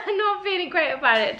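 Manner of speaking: real laugh spilling into the words; rueful laugh. Real, not acted. Vlogger laughing at her own illness, a rueful laugh. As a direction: laugh it off though sick.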